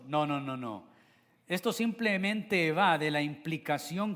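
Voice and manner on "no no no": ordinary talk, nothing marked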